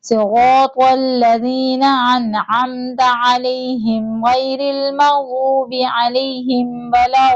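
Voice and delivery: slow, drawn-out melodic tajweed recitation; Quran recitation. Shown as a demonstration. Teacher reciting Surah Fatiha for students to follow, slow melodic chant. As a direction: chant each verse slowly with long held vowels.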